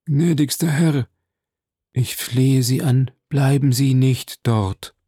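slow, drawn-out plea; high-voiced, frightened begging; imploring. A narrator's reading. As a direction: beg your master, stretching each word. Terrified steward begs his master.

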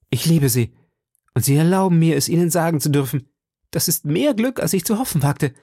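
put-on a young man blurting out his love; eager young lover. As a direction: pour it out, pitch climbing with joy. D'Artagnan declares his love.

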